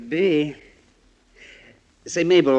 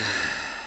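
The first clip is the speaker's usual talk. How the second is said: tired sigh; 5 semitones lower, 7 dB quieter than usual. Acted. Hank alone after goodnight.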